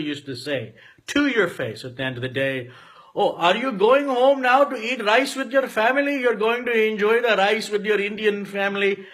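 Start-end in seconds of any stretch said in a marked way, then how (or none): 3.1-9.1 condescending taunt, pitched up; mocking teacher. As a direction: taunt him in a sing-song put-on voice. Teachers mocking him at school.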